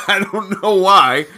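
loud laughing admission, drawn out; helpless amused outburst. Real, not acted. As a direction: say it through a laugh. Laughing after the impersonation.

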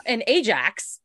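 ordinary talk

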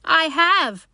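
high, loud little rabbit protest; indignant cry. A narrator's reading. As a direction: cry it out in defiance. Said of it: "cried the little rabbit".

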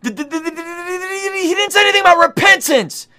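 high, whiny mock objection, stammering; squeaky caricature of a nitpicking skeptic; mocking critic. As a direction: mimic a sputtering critic. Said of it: "some of you guys are gonna say".

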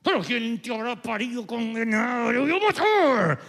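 arrebato de rabia. Retold or imitated. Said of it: "Entonces explota y te salen unas palabras".

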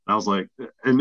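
usual speaking voice

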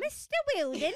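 high, loud hammed-up Cockney; laughing mock-Cockney cry; Cockney accent. Teasing co-host over the officer's name, hammed-up Cockney. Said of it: "had to be done in a Cockney accent".

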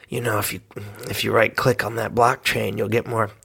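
a soft, low, wet-mouthed ghost murmur; juicy whisper in ear. Pretend. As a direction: slobber it softly into their ear. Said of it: "a really juicy mouth".

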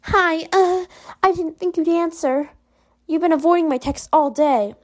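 Ruby. Acted. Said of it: "Oh, it's Ruby".

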